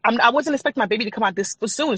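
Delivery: normal talking voice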